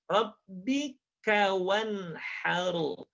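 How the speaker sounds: "Rabbika" slowly drawn out with a hissed K; hissing K. Shown as a demonstration. Quran teacher modelling the verse, "Rabbika" with an airy K. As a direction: recite "wanharu" very slowly, careful K before it. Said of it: "Ka-nya jangan lupa mendesis".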